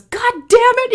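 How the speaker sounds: high, loud mock outburst of disgust; wife mocking husband reeling from rotten seafood smell, loud high yell; mocking husband's yell. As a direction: yell it like the husband hit by the fridge stink. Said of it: "I have to mock him so you guys know".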